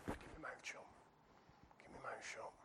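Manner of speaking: tiny, soft near-whisper; acted timid muttering; meek self-hushing. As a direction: shrink back and murmur it to yourself. Timid person not disturbing anyone.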